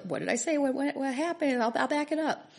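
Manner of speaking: high, rushed bewildered backpedalling; husband caught off guard, backing down fast; flustered husband. Retold or imitated. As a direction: play the confused husband scrambling to retract. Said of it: "My husband probably had no idea what".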